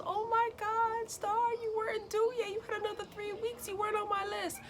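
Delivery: high, surprised put-on voice of her doctor; imitating the doctor. Doctor finding her patient in labor early, high mock-surprised voice.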